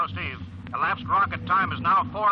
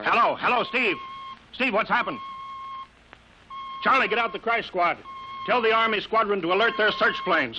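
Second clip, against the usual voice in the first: anxious calls; 7 semitones higher than usual. Acted. Controller lost contact with pilot.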